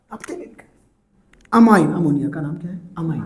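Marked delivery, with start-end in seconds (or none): 0.1-0.7 very soft, voiceless aside; whispered aside. Real, not acted. Teacher reminding students it is written for them, whispered. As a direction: drop right down to a whisper. Teacher repeats it under his breath.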